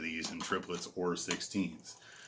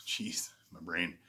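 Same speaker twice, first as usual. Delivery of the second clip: soft, half-whispered aside to yourself; muttered self-reproach; 4 semitones lower, 8 dB quieter, slower than usual. Real, not acted. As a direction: mutter it under your breath, slowing down. Muttered aside after losing his words.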